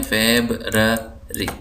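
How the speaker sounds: slow February, FEB, ra, ri; syllable by syllable. Shown as a demonstration. Teacher counting three syllables, February slowly split. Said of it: "तीन syllable हैं".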